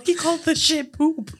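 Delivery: high, drawn-out silly voice; imitated movie character. Quoting another Billy Madison line, high silly voice. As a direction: squeeze it out high, amused. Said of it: "I also thought he's all".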